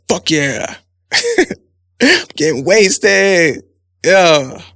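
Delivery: loud, slow whooping party yell; hammed-up buck-wild college girl; whooping drunk party shout; wild party cheer.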